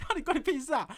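high, loud, laughing through the words; outraged retort, laughing. Retold or imitated. Inner reply to the masseur, loud and laughing. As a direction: fire back in disbelief while laughing. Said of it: "我想说".

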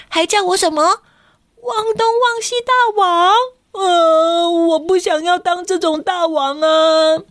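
put-on high, whining child near tears; whiny distressed child. Kenta whines he doesn't want to be king. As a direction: protest the silly title.

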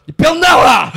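retold loud shout. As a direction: yell telling a friend to knock it off. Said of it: "黄汉就很大声说". (黄汉隔着布帘大喊别闹了)

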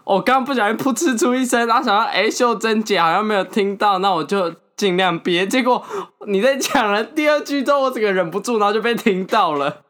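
high, giggly voice; stifled giggles. Real, not acted. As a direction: still fighting back giggles.

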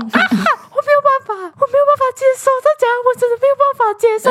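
high, laughing exasperation; can't accept it. Real, not acted. Friend venting to friends about the drama, laughing outrage.

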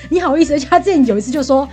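usual speaking voice

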